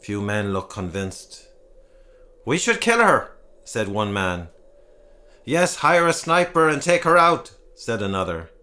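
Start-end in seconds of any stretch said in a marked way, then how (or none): 2.4-3.3 much higher and louder, calling for blood; angry townsman shouting in the meeting. Acted. 5.4-7.5 much higher and louder, calling for blood; angry townsman shouting in the meeting. Acted.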